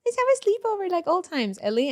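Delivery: high, quick pleading sister voice; mock high voice, rushed invitation; imitating sister. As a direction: put on a perky, high-pitched sister. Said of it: "She'll be like".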